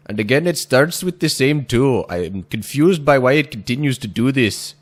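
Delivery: loud hammed-up Indian accent; lightly put-on Indian accent. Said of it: "that last one was my attempt at doing, like, an Indian accent".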